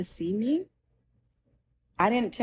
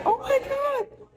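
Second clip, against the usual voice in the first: high, drawn-out retold cry of pain; imitating a scream without extra volume; mock scream; slower than usual. Patient reacting when he touched her arm, high retold scream. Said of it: "I screamed".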